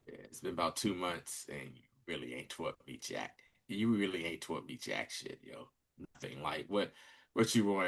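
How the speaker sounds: low, very soft retold grumble; low-key complaint. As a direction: play a trainee quietly fed up. Acted complaint of trainee to mentor.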